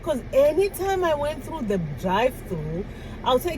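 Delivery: usual speaking voice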